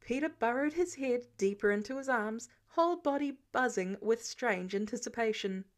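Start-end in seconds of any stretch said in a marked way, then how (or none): none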